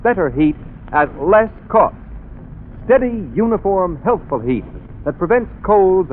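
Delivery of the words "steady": normal talking voice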